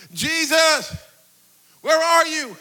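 high, drawn-out cry for help; acted yelling. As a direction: call out as if searching desperately in a storm. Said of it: "we start yelling and freaking out".

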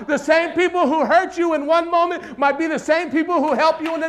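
loud, high, insistent sermon; full-force preaching. Real, not acted. Preacher tells congregation not to isolate from people, full-force preaching. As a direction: hammer it home at full volume.

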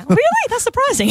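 high, loud sarcastic really; sarcastic mock disbelief; mock surprise. Mock surprise at Bex's age.

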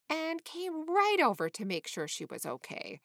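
usual speaking voice

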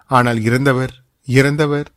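usual speaking voice

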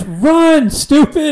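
high, loud urgent yell; retold yell. Friend yelling at the man who stopped, urgent yell. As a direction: yell it up high at someone too slow. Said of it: "Dane yells".

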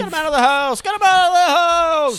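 screaming at the burglar; mock scream. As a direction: shriek it in hammed-up panic. Acted scream during robbery.

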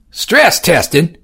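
high, slow doubtful echo; skeptical. Acted. Edward skeptically echoing Johnson. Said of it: "Edward was skeptical".

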